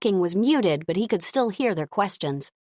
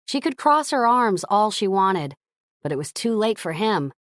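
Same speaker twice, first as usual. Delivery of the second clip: usual speaking voice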